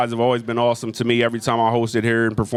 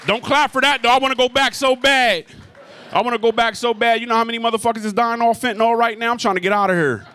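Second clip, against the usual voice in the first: high-pitched comic outburst; mock protest; 9 semitones higher than usual. Comic telling the crowd not to clap for sobriety, high mock protest. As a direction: whine it high, in mock desperation.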